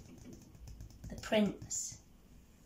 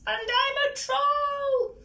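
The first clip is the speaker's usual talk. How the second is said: very high little troll squeak; very small voice; 4 dB louder than usual. A narrator's reading. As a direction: pipe it up in a tiny high voice. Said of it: "in a very small voice".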